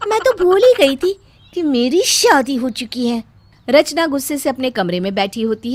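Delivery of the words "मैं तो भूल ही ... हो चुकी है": sting her with irony; sarcastic thanks. Acted. Bride's sarcastic reply.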